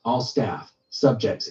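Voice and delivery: usual speaking voice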